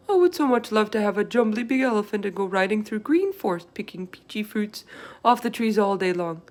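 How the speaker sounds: the giant lost in his elephant dream; dreamy, excited giant. Acted. Giant voiced in read story.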